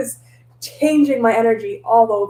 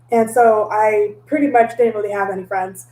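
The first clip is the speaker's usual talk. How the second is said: normal talking voice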